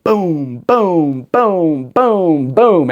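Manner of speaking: stack the booms like peaks lighting up; building booms. Retold or imitated.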